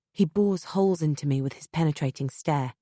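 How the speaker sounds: ordinary delivery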